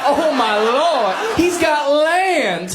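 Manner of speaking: very high, loud, drawn-out mock swoon; hammed-up female ecstasy, stretched out; swooning country women. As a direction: squeal it slowly like smitten farm girls. Acts out swooning hometown women.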